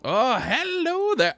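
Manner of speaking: high, slow, drawn-out Scottish greeting; attempted Scottish accent. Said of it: "trying to go for a Scottish accent".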